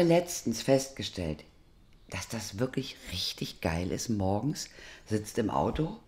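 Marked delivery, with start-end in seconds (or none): 2.1-4.1 soft conspiratorial whisper; whispered aside. Real, not acted. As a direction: lean in and whisper it. Confiding aside.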